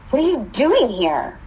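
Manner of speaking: high-pitched startled question, falling at the end; startled demand. A narrator's reading. Girl confronting the boy she met last winter, startled. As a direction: demand it in mild disbelief.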